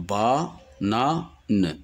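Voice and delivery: "banane" split into ba, na, ne with pauses; syllable by syllable. Shown as a demonstration. Teacher demonstrating three syllables, syllable by syllable. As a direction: clap out each syllable of "banane" vocally. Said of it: "Le mot banane contient trois syllabes".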